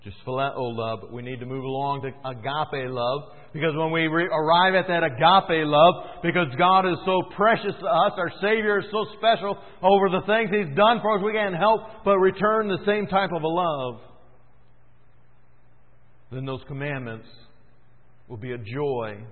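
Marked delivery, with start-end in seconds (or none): 3.5-14.0 louder, lifted pulpit voice; preacher rising in praise; fervent preaching. Acted. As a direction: swell with joy at God's love.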